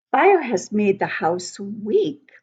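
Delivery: normal talking voice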